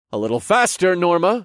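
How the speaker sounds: loud, high-pitched prodding shout; urgent calling out. Acted. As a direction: call across the fight to hurry your partner. Hero calls to partner across fight.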